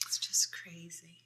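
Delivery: soft, whispered aside; hushed aside. Real, not acted. Aside to a friend about a crazy film, hushed. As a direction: drop to a whisper.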